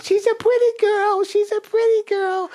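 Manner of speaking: very high, childlike put-on voice. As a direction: coo simply like an impaired innocent, faked.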